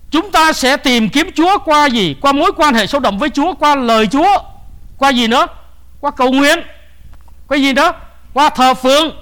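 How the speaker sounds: high, loud question-and-answer exhortation; forceful preaching. Acted. Preacher urging congregation to seek the Lord, rousing and loud.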